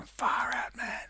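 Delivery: whispered, dazed "far out, man"; mock stoner whisper. Alone at the desk, whispering in mock awe. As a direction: breathe it like a stunned hippie. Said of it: "I just sit here going".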